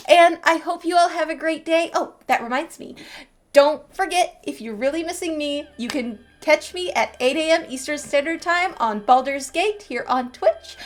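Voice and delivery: ordinary delivery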